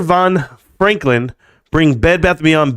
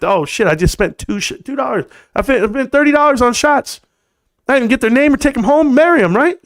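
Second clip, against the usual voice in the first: higher, faster rueful complaint; mock regret; 9 semitones higher than usual. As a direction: whine about the wasted money. Retold regret at the bar.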